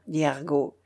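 ordinary delivery

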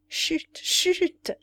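playful shush, jumping high and loud; cheerful shushing. A narrator's reading. Aide-de-camp hushing the betting lieutenant, gaily. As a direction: hush them merrily, the second one bigger. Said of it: "dit gaiement l'aide de camp".

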